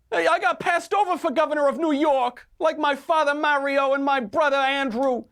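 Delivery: high-pitched Italian American whine of complaint; Fredo impression. Commentator mocking an anchor as Fredo, high-pitched impression. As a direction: do an aggrieved movie brother, voice pushed way up. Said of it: "you just did an Italian accent".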